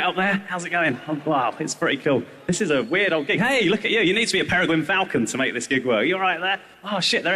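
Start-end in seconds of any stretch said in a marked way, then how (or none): none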